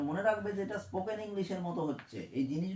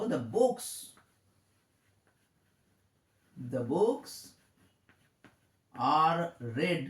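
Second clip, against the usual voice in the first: slow dictation; 2 semitones lower, much slower than usual. Shown as a demonstration. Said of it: "এখানে বলবো".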